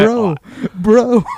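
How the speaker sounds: loud hyped bro voice; mock teen dude talk. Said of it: "thirty-five-year-old dudes writing teenage, young adult dialogue".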